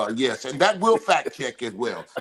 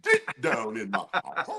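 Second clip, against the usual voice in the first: higher, laughing tease; 10 semitones higher than usual. Real, not acted. Laughing at K-Mac, playful teasing. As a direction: tease them while laughing.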